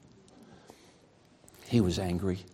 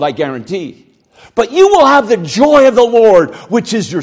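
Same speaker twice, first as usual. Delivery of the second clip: fervent preaching; 8 semitones higher, 10 dB louder than usual. Acted. Preacher urging the congregation to join God's joy, fervent. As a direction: urge them on with fire.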